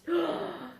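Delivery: high staged gasp; mock gasp. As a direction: gasp in wonder. Fifty forests burnt.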